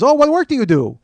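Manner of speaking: high, loud, eager question; nosy seatmate. Retold or imitated. Passenger asking comedian about job, eager seatmate. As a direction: pounce on the new topic.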